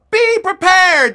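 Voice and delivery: very high, loud, stretched-out shout; imitating a troop's chanted yell; yelled in unison. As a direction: holler it like kids answering their leader. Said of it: "the kids yelled in unison".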